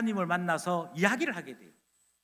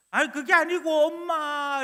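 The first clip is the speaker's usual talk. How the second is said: put-on whining child; 6 semitones higher, 4 dB louder than usual. Child whines to mother.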